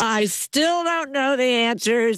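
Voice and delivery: hammed-up student panic; mock panic. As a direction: wail in mock alarm about an exam. Acts out panic at chemistry test.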